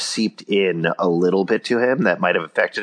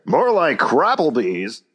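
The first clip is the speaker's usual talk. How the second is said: slow, high, big announcer read; commercial announcer; 7 semitones higher, slower than usual. Shown as a demonstration. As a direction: sell it like a TV ad voice. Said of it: "I'll say it more like a commercial announcer".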